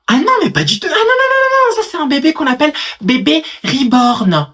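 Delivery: high, loud outraged protest; shrill-pitched indignant doll mum correcting strangers; indignant squeal. Acted. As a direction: squeal your denial up high. Indignant reborn-doll mother.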